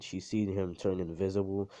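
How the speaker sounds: plain reading voice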